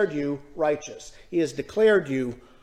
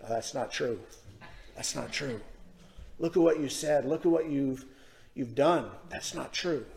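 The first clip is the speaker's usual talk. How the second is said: low, very soft voiced murmur in the ear; Satan's whisper; 4 semitones lower, 8 dB quieter than usual. Satan sowing doubt in a believer's ear, hushed. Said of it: "come and whisper in your ear and say".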